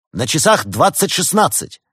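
high, loud taunting call; 1 teasing announcement. A narrator's reading. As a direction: announce it to annoy them. Подросток дразнит, объявляя время. (подросток нарочно громко объявляет время)

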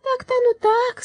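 high metallic doubtful tone; doubtful robot voice. A narrator's reading. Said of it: "сомнением заметил он".

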